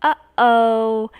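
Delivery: gasping mock uh-oh at a mistake. Teacher to class, mock gasp and uh-oh. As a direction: act out spotting a mistake.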